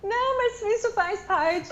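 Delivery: high, laughing through the words; amused protest while laughing. Real, not acted. Laughing at the vowel joke.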